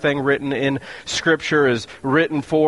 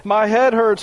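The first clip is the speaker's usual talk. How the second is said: crying out; 9 semitones higher, 3 dB louder than usual. Acted. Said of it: "Suddenly he cried out".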